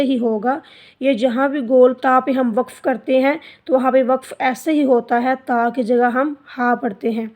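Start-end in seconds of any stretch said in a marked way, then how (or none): none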